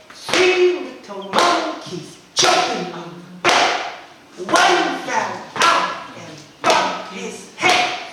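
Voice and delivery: high, loud, slow rhythmic chant; clapping-rhyme chant, big beats on stressed syllables; exaggerated stressed syllables. Shown as a demonstration. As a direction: punch each stressed syllable, stretching it out. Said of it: "I'll exaggerate my, uh, my, uh, action and voice".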